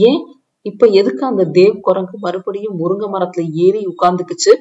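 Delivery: loud, rapid exasperation; irritated. A narrator's reading. Grandmother complaining about Dev's stubbornness, irritated. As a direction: fire off an irritated question. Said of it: "எரிச்சலாக கேட்டார் உஷாதேவி".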